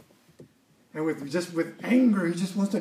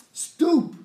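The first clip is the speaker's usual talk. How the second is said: Stoop called out high, then falling; emphatic stoop; 8 semitones higher than usual. Acted. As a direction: land the word Stoop like a command. Preacher emphasizes key word.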